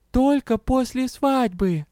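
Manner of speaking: high, slow kitten voice; talking kitten. A narrator's reading. Winged kitten suddenly speaking up, high-pitched. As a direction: squeak it out as a tiny kitten. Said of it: "маленький крылатый котёнок".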